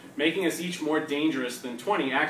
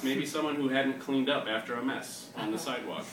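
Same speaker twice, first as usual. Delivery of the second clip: usual speaking voice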